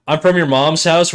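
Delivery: normal talking voice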